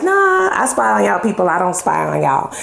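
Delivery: ordinary talk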